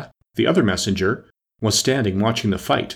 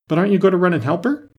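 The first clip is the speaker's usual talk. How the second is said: fast, high-pitched astonished question; surprised, urgent query rising high; 8 semitones higher, 3 dB louder, faster than usual. A narrator's reading. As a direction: ask it quickly, taken aback. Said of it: "very much surprised at his taking it so quietly".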